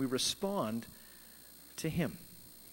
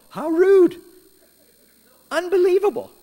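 much higher and louder, mock outrage; indignant exclamation; 13 semitones higher, 12 dB louder than usual. Real, not acted. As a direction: gasp it out, scandalised. Preacher exclaims at Jonah.

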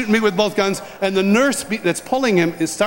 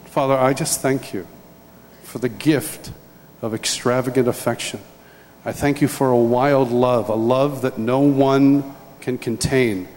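heartfelt prayer; 3 semitones lower than usual. Real, not acted. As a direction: pray over the congregation, earnest and unhurried. Leads closing prayer.

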